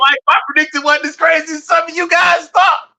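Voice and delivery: high, loud shout of a viewer's comment; shouted quote. Retold or imitated. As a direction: read the comment out like a triumphant shout. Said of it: "He said".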